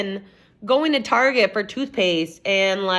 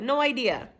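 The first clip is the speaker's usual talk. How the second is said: normal talking voice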